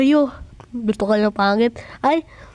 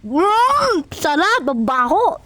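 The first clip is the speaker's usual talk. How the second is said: ordinary talk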